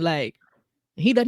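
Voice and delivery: ordinary talk, nothing marked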